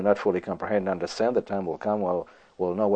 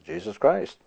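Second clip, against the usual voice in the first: usual speaking voice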